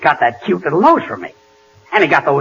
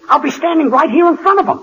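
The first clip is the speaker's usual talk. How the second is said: incredulous protest; 11 semitones higher than usual. Acted. Protesting the absurd plan.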